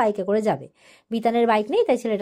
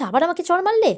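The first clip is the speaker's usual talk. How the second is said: outraged shock; 7 semitones higher, 4 dB louder than usual. Retold or imitated. Inka slapped again, outraged.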